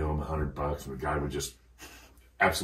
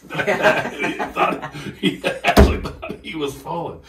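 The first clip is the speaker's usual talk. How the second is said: higher, louder, breaking up with laughter; laughing; 10 semitones higher, 9 dB louder than usual. Real, not acted. Telling the host how his friend woke up falling, laughing. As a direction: struggle to tell it through laughter.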